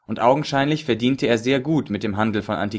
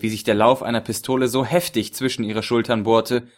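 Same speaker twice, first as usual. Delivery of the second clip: plain reading voice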